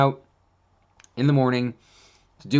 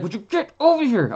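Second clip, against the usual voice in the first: much higher, louder mock yell; frustrated yell; 12 semitones higher, 5 dB louder than usual. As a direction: call a dawdling dog, fed up. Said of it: "I would be like".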